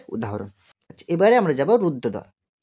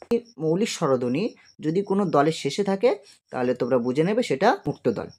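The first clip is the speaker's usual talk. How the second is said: ordinary talk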